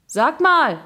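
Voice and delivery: high, loud call; calling after. A narrator's reading. Jakob calling after his friends on the pitch, shouting. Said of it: "rief er uns hinterher".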